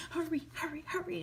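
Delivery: urgent whispered hurry; inner voice whisper. Acted. Dan's inner voice urging him on, urgent whisper. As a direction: whisper it like a voice in his head. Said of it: "a voice inside him cried".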